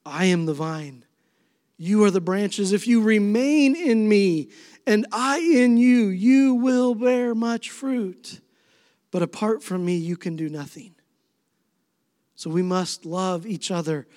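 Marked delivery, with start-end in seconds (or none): 0.1-10.9 preaching scripture. Acted. Pastor repeats Jesus' vine verse to the congregation, building then hushed. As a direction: swell on the fruit and fall away. Second reading of same verse.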